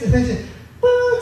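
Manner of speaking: high, sudden imitated puff of breath; mock blow.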